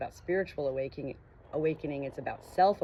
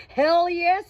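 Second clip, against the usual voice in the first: much higher, loud eager burst; eager growl; 14 semitones higher, 13 dB louder than usual. Acted. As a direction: shout it out, thrilled. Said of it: "he growled".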